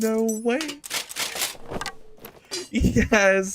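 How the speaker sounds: high-pitched ecstatic shrieks, slow and stretched; shrieking jackpot winner. Real, not acted. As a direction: scream with joy like you hit the jackpot. Jackpot win in game, screaming.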